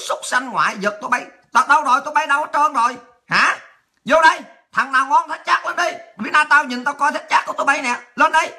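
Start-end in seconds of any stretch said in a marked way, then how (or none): 1.5-8.5 higher-pitched, combative call-out; taunting challenge. Real, not acted. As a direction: dare them to show themselves. Challenges absent opponents to come up. (lớn giọng thách thức, gọi ra mặt)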